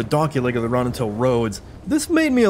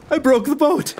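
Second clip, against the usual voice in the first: laughing; 9 semitones higher than usual. Real, not acted. Laughing at breaking the boat.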